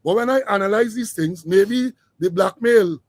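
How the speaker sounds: ordinary talk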